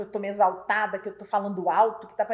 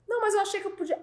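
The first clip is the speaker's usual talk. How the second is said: high-pitched mock justification; imitated excuse; 10 semitones higher, much faster than usual. Employee justifying himself to the boss, high-pitched excuse. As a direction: plead the excuse in a put-on higher voice.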